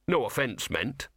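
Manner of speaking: plain reading voice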